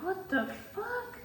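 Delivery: high, soft, stunned what the fuck; mock a stunned double take; disbelief.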